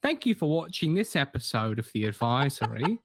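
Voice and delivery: mock accent drifting toward northern English; lightly done mixed northern English accent; wandering accent. Co-host signs off in a globe-trotting mock accent. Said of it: "That accent, that accent took us all around".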